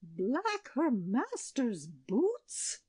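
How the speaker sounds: very slow, high wail; mild shriek. A narrator's reading. Queen shrieking at the shepherdess's words, mildly. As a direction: shriek in dismay, understated. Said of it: "shrieked the queen".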